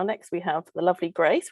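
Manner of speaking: usual speaking voice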